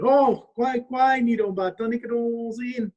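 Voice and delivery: high, loud sly greeting call; cheeky high raccoon hailing a bird; raccoon trickster voice. Acted.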